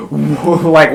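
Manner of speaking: usual speaking voice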